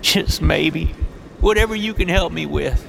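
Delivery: high, hoarse, begging; pleading homeless man. A narrator's reading. Robert begs James for money, high and desperate. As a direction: plead while stumbling closer.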